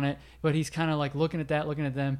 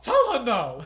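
laughing; 8 semitones higher, 4 dB louder than usual. Real, not acted. Teasing each other.

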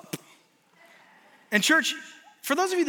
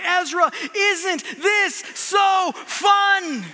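higher, louder, very slow, yelled over the ride; shouted tease; 8 semitones higher, 4 dB louder, much slower than usual. Retold or imitated. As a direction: shout the question down the drop. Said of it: "I say".

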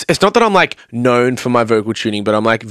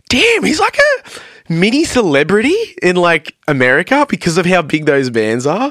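awed excitement; 6 semitones higher than usual. Retold or imitated. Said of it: "we're like".

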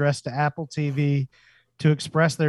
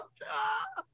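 high squealing laugh; 12 dB quieter than usual. Real, not acted. Laughing at own joke.

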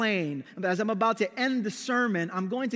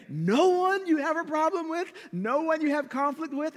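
high-pitched disbelieving questions; incredulous probing; 7 semitones higher than usual. Retold or imitated. Senior pastor doubts his colleague's denial, incredulous probing. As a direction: press in disbelief, voice pitched right up.

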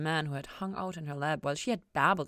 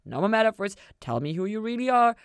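louder, eager insisting; loud eager retold insistence; 4 semitones higher, 9 dB louder than usual. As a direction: push him, eager and sure. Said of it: "I just kept on insisting".

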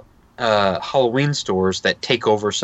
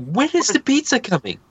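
put-on high-pitched impatient child voice; whining hungry kid; 13 semitones higher than usual. Parent imitating son ten minutes into party, high impatient whine. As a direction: mimic a kid pestering for food.

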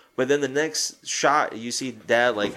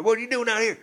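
much higher-pitched mock dad demand; imitating dad; 10 semitones higher, faster than usual. Dad confronting both sons outside, high mock scold. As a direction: ham up the father's question, pitched way up. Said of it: "Dad's like".

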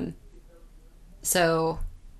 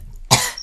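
sudden cough on spit; choking cough. Real, not acted. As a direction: cough through a choke. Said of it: "I'm choking on my own spit".

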